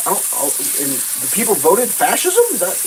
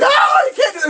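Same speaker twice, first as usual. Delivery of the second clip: high mocking whine, pitched way up; mocking whiny voice; 6 dB louder than usual. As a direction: mimic the outraged crowd in a silly high voice. Mocking people's outrage.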